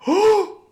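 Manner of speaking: sharp, high gasp of sudden realization; a loud, startled intake of breath; shocked gasp. Retold or imitated. Dentist reliving the moment he realizes his mistake, shocked gasp.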